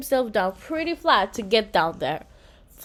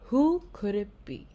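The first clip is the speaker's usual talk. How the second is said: usual speaking voice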